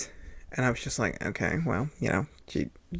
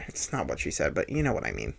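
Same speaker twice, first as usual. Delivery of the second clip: normal talking voice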